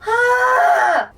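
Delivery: loud, high wailing "ah"; distressed cry. Real, not acted. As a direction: cry out sharply in anguish. Cries out over the book.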